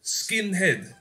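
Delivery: drawn-out hum, then the word in a put-on Japanese accent; imitated Japanese accent. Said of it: "forgive my racist Japanese accent".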